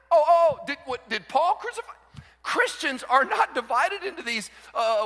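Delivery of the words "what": usual speaking voice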